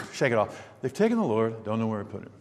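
soft, low, offhand shrug; casual, indifferent mumble; shrugging it off. Retold or imitated. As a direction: say it as if it hardly matters. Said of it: "I can't imagine her just saying".

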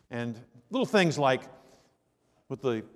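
usual speaking voice

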